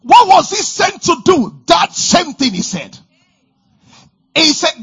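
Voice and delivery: loud, high, rousing preacher voice; preaching at full force. Acted. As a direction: drive it home to the congregation.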